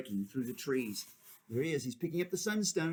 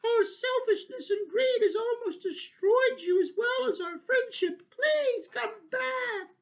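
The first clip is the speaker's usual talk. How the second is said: high, loud tearful plea ending slow; high pleading cry, slowing at the end; pleading creatures; 5 dB louder than usual. Acted. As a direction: beg him to return.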